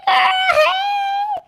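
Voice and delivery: high, loud shouted hey. Real, not acted. Host calling out to a viewer in the chat, a high shout. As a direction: call out to a friend in the chat.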